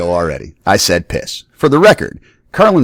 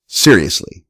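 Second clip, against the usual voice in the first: usual speaking voice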